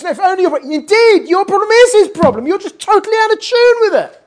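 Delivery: loud, high, anguished preaching cry; impassioned rebuke. Retold or imitated. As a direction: cry it out at full force, pitch soaring. Rebbe's impassioned retort to cabbie, retold.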